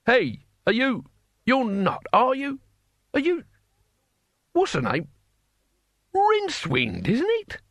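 high, wavering pitch, halting recognition; old man, searching for a name. Acted. Old man hails Rincewind uncertainly.

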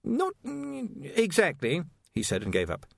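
high, stammering, anxious voice; nervous young wizard. Acted. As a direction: stumble over the words nervously.

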